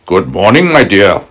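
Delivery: crafty wolf greeting sweetly. Acted. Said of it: "he said".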